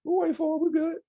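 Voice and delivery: imitating eager students. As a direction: chirp it like friendly kids. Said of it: "They were like".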